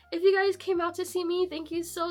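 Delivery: normal talking voice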